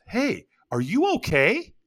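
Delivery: high, slowed questioning voice; puzzled, drawn-out question pitched high; puzzled stranger. Retold or imitated. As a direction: imitate a puzzled stranger checking in. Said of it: "with a puzzled look on his face".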